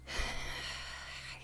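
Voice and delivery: long, soft exhale; weary sigh. Acted. Mom avoiding son's question, weary sigh. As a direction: sigh before avoiding the answer.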